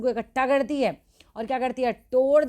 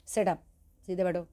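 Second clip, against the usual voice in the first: order; 2 semitones lower than usual. A narrator's reading. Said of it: "he ordered".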